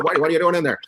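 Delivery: ordinary talk, nothing marked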